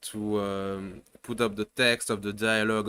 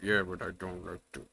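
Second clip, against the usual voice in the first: quick, quiet, offhand mimicry; mock lazy shtick; 4 semitones lower, 7 dB quieter, faster than usual. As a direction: toss it off like a bored shrug. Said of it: "the tone and the shtick you do".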